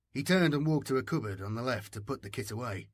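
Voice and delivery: usual speaking voice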